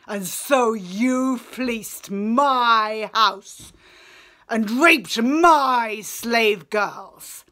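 loud, slow, contemptuous snarl of a returning king; scornful sneer. Acted. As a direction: spit each accusation out slowly and loudly. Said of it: "scowled back and sneered".

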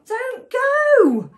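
high, loud call easing into delight; cheery storybook pleading; happy plea. A narrator's reading. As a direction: call out eagerly, then happily. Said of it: "sighed Safi happily".

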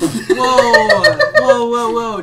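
high, loud whoas; mock protest. Objecting to friend's mean remark, high mock-shocked whoas.

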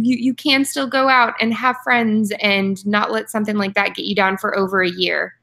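ordinary delivery

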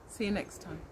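plain reading voice

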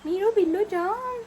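put-on high sweet baby talk; cooing. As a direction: coo lovingly to the cat. Cooing to her cat. (मांजरीशी उंच लाडिक बोलणे)

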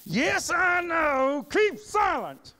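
high, slow, loud ticked-off shout. Acted. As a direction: shout it at the pestering students. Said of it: "He's, he's shouting at him".

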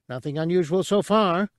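high little alien voice; small creature voice. Acted. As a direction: pitch it up for a tiny creature. Cabe's lines.

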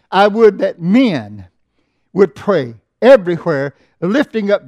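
loud, slow, ringing pulpit reading; preaching the text at full voice; scripture reading. Acted. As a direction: proclaim the verse to the congregation. Scripture read slowly.

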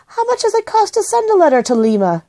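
put-on high-pitched voice of a curious boy; boy's question. A narrator's reading.

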